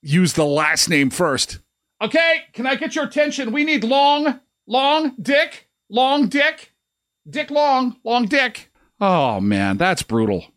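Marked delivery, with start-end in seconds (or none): 2.0-8.7 high, fast roll call announcer; calling out names. Pretend.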